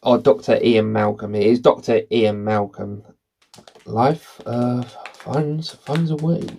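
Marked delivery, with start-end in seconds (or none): 3.9-6.5 a light, halting attempt at the movie mathematician's pauses; a half-hearted impression with stretched hesitations; terrible Jeff Goldblum impression. Quoting Jurassic Park to viewers, a lightly done bad impression. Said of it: "another terrible impression".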